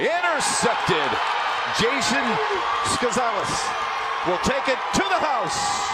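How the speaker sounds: high, loud, stretched-out play-by-play shout; roaring sportscaster's big-play call; excited touchdown call. Real, not acted. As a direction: erupt on the interception and draw it out. Live call of interception touchdown.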